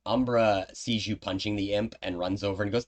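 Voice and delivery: usual speaking voice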